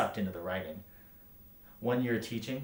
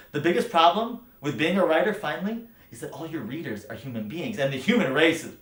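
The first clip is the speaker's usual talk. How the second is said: loud defiance building higher; impassioned rant; 6 semitones higher, 9 dB louder than usual. Acted. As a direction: build from raised voice to a high, loud outburst. Acted rant building to an outburst.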